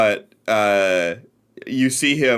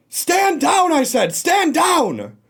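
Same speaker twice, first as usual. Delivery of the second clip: commanding shout; slower than usual. Acted. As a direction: shout the order across the distance. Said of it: "you hear Zahn off in the distance".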